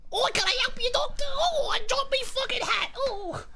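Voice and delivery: high-pitched hammed-up doofus voice; bumbling doofus. Voicing an imagined bumbling follower.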